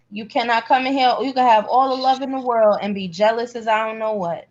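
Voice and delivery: normal talking voice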